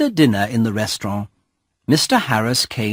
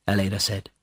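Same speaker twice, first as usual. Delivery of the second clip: plain reading voice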